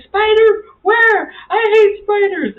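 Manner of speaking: high loud alarmed voice; panicked. Retold or imitated. Husband hearing about a spider, high alarmed panic. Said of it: "And Ron's like".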